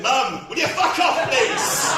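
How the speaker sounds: loud, high, slow plea; exasperated shout. Acted. Comic leaning over to his heckling mum, exasperated loud shout. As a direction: yell it slowly in exasperation.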